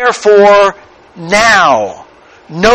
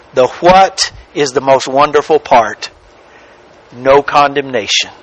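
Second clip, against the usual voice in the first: ordinary delivery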